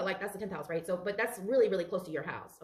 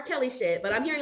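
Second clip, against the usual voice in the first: usual speaking voice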